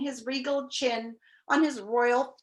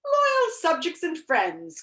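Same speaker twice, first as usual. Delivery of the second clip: slow, higher, ringing address; royal oration; 8 semitones higher, 4 dB louder, slower than usual. A narrator's reading. King addressing an almost empty room, slow oratory. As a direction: proclaim it to a big hall. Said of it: "his voice echoing in the almost empty room".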